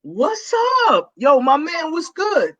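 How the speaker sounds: high-pitched, acted hello to a buddy; hearty greeting. Pastor acting out greeting a member's husband, high hearty hello. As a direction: hail him like an old friend, pitch way up.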